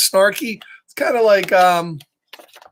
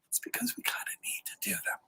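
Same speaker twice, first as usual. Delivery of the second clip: fast secret whisper; 20 dB quieter, faster than usual. Real, not acted.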